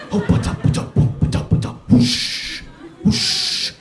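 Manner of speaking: voiceless rhythmic puffing and whooshes; imitated ritual noises. Sons perform the medicine ritual, mock ritual puffing. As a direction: puff out syllables, then blow long whooshes.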